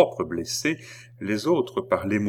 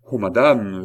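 louder, acted cry of protest; exclamation; 7 dB louder than usual. A narrator's reading. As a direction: exclaim it to the queen. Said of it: "s'écria le jeune homme". (exclamation jouée, un peu forte)

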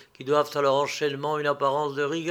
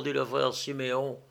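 ordinary delivery

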